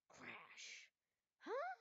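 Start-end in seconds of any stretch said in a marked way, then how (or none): 1.4-1.7 shocked huh. Acted. Pilot shocked at the crash.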